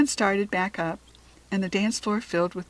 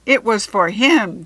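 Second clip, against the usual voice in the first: louder, slow protest; cried out; 10 dB louder, slower than usual. A narrator's reading. Jack Frost protesting to the goblin, crying out. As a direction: call out in dismay. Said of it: "Jack Frost cried".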